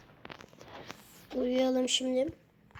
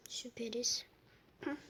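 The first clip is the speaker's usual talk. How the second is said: whisper; 8 dB quieter than usual. Real, not acted. Lost, sarcastic aside to self.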